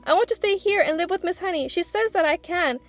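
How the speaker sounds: high urgent begging; beg, gasping for air; breathless plea. A narrator's reading. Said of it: "Matilda burst out, gasping".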